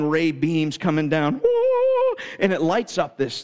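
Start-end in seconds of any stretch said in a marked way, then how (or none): 1.4-2.2 a high, bright angel-choir woo; mock heavenly woo. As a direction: sing a short, high heavenly note. Heavenly light on the menu.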